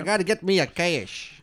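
bad Australian accent. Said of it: "I thought that was a horrible, uh, Australian accent".

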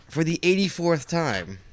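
high, slow, drawn-out announcer voice; grand, measured intro. Pretend. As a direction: stretch it out like a show opener. Announcer-style intro to the segment.

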